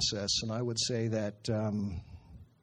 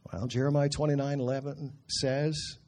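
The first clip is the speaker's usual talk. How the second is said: ordinary talk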